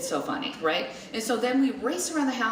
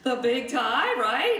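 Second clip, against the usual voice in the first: louder, higher, eager questioning; imitated giddy brother; 4 semitones higher, 4 dB louder, slower than usual. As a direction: egg them on, imitating a giddy teen. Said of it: "my brother's dying laughing".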